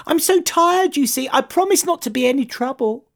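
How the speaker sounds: very high, pleading moth voice; high-voiced tired moth; apologetic little moth. A narrator's reading.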